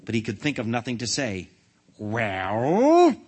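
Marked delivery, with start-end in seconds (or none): 2.0-3.2 loud, drawn-out demand swooping upward; impatient demand. A narrator's reading. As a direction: stretch it out, demanding an answer. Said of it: "demanded the king again".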